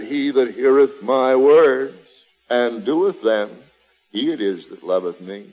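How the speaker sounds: solemn, low reading of the verse; scripture reading. Acted. Preacher intoning Jesus' words to the congregation, solemn and low. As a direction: read it as holy writ, measured.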